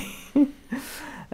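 short light laugh; a quick amused laugh. Real, not acted. Laughs at being recorded.

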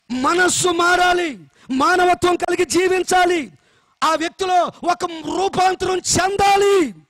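loud, slow, emphatic sermon delivery; forceful preaching. Acted. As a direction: drive each point home to the congregation. Preaching to congregation.

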